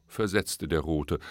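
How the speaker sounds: ordinary delivery, nothing marked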